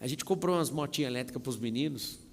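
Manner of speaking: ordinary talk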